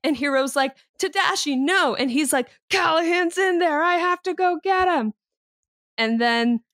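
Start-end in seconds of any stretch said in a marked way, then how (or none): 1.0-1.9 pleading cry. Retold or imitated. Hero begging his brother. 2.7-5.1 urgent insistence. Retold or imitated. Tadashi voiced insisting.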